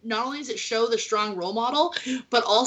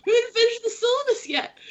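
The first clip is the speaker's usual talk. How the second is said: much higher, mock-shocked protest; mock dismay; 11 semitones higher, faster than usual. Teacher realizing class ended before the syllabus, mock dismay. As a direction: squeak out your disbelief.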